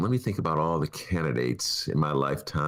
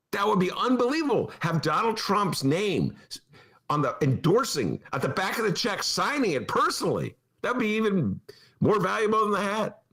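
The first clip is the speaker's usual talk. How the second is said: gleeful; 12 semitones higher than usual. Real, not acted. Gleeful imagining a cashed penny.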